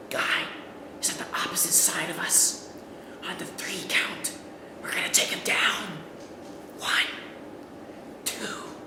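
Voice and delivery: soft, unvoiced whisper; whispered plan. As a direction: whisper the plan so the enemy can't hear.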